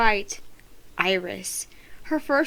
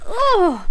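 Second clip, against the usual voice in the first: high, sharp ugh of disgust; disgusted cry; 10 semitones higher, 4 dB louder than usual. A narrator's reading. Tasha sits in soda puddle, disgusted cry. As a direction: yelp at sitting in soda. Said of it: "She let out another cry".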